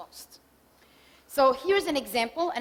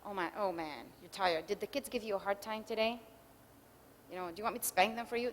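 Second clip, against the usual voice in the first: caring response; 4 semitones lower, 8 dB quieter than usual. Shown as a demonstration. Husband comforting his tired wife, soft and tender. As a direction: lower and softer, gentle sympathy. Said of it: "turning towards would be".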